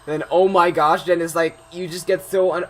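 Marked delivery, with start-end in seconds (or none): 0.3-1.0 louder, slightly higher mock gasp of shock; retold overwhelmed fright. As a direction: re-enact being overwhelmed by a sudden scare. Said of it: "overwhelmed that your voice just doesn't".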